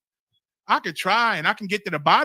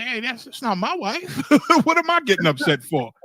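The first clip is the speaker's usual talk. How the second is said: chuckling it off, louder, half laughing; laughing at himself; 6 semitones higher, 5 dB louder than usual. Retold or imitated. General laughing it off to a brother. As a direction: laugh off a needless anger. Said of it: "He said".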